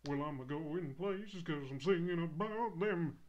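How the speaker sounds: loud singing-star swagger; half-sing it loudly like a cocky crooner; hammed-up crooner voice; imitating the movie singer. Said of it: "He's like".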